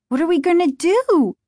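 high, loud cry of panic; panicked, desperate. A narrator's reading. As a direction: plead desperately for a way out. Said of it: "panic and desperation in her voice".

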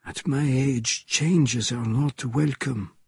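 put-on slow, measured elderly French character; old French character objecting, unhurried; old Frenchman. A narrator's reading. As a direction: take it slowly, as an aged Frenchman. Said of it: "the old Frenchman said".

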